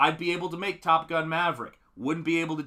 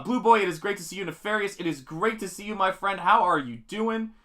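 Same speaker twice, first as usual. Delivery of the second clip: higher, friendly greeting to chat; schmoozing; 5 semitones higher, 4 dB louder than usual. Real, not acted. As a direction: gush over each viewer by name. Greeting channel members on stream.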